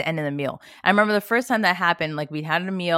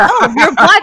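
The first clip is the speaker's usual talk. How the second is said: mock accusing shout; 9 semitones higher, 12 dB louder than usual. Voicing the racist guy.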